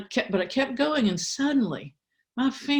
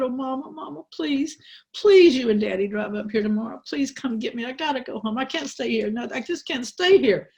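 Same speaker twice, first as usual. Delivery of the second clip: higher, tearful-edged begging; pleading child; 6 semitones higher than usual. Retold or imitated. Girl on the phone begging her mother to fetch her, pleading. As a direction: beg Mama to come take you home.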